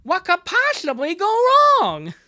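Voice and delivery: high, loud cartoon catchphrase; goofy, high-pitched impression, pushed hard; Bubsy. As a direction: belt out a lisping mascot tagline. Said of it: "if you watch the Bubsy cartoon".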